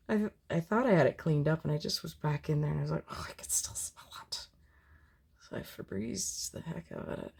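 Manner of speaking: whispering. Real, not acted. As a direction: whisper it close to the mic. Whispered story aside.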